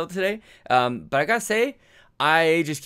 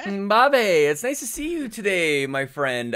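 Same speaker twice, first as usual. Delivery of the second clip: higher, louder, slow sing-song hello; playful greeting; 8 semitones higher, 4 dB louder, slower than usual. Acted. Co-host greeting the host at the show start, in a playful sing-song. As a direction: greet your buddy with a teasing lilt.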